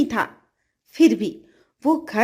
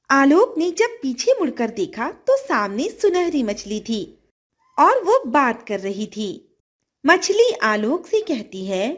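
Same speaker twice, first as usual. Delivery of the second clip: usual speaking voice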